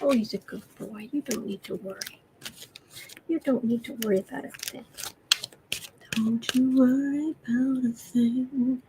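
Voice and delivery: very soft, soothing coo; tender murmur to pet. Real, not acted. Owner reassures nervous cat, very soft coo.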